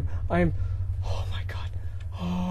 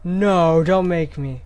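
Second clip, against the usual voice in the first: loud frightened plea; 8 dB louder, much slower than usual. Real, not acted. Pleading with game.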